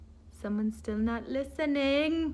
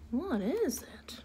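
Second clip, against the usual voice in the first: whispered; 9 dB quieter than usual. Acted. Whispered question to kids.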